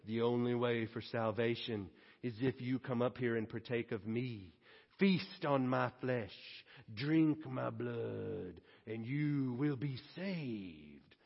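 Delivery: low, slow, ominous mock prophet voice; hammed-up creepy guru inviting you to eat him; mock cult leader. Mock cult leader offering his flesh.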